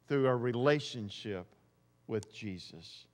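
hushed. Acted. Preacher ending his point to the church, hushed. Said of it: "soft drop at point's end".

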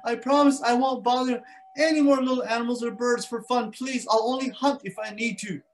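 high, loud pleading; begging lion. Acted. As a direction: beg for mercy, high. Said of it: "finally the mountain lion goes".

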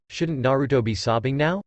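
usual speaking voice

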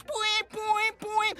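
bouncy sung-out boings; boing sounds. Acted. Man acting out the spa ride, bouncy boings. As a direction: bounce each boing like a spring.

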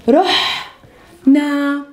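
رحنا said slowly as "rooh-na", the h breathy from the throat; slow model word. Shown as a demonstration. Teacher modelling رحنا slowly for learners. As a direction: draw out رحنا so every sound is clear. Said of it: "إحنا we say".

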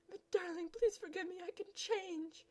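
high, begging whine; high theatrical begging; pleading suitor. Acted. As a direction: plead to win her back.

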